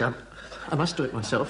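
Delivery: normal talking voice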